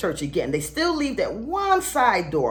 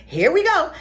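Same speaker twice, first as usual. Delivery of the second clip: wry here-we-go; 8 semitones higher, 4 dB louder than usual. Retold or imitated. Her wry reaction to being hailed.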